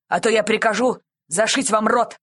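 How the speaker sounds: shouted threat. A narrator's reading. Policeman threatening the bandits into silence, calling out. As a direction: threaten them into silence with authority. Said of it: "крикнул полицейский".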